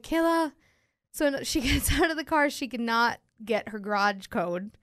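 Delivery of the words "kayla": higher call of the name; calling to wake. Retold or imitated. Waking a passed-out friend, high call.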